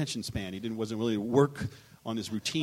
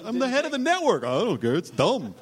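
mock network boss; 11 semitones higher, 7 dB louder than usual. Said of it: "And he goes".

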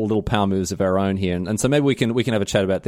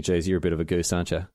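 muttering to himself, softer and lower; a quiet private put-down under the breath; inner thought; 6 semitones lower, 6 dB quieter than usual. Retold or imitated. Host voicing his unimpressed thought at a playmat show-off, muttered. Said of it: "I just sit there and thinking".

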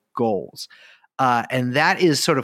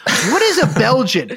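mock outrage; 11 semitones higher, 5 dB louder than usual. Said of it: "These are all good questions".

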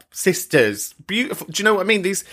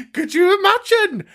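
laughing disbelief; 4 dB louder than usual. Real, not acted. Laughing at her fake reveal.

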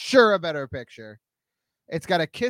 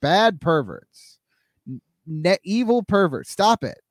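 mock scolding; 3 semitones higher, 5 dB louder, much slower than usual. Scolding imaginary perverts.

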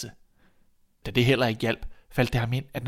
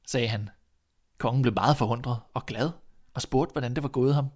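plain reading voice

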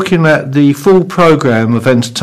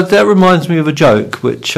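normal talking voice

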